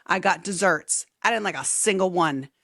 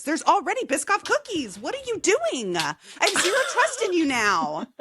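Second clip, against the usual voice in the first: exasperated; 8 semitones higher than usual. Real, not acted. Mock exasperation at cookies.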